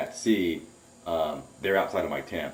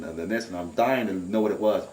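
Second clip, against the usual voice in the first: usual speaking voice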